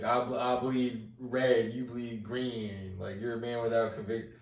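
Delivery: Avon Barksdale. Retold or imitated. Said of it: "what does Avon Barksdale say to fucking stringers like".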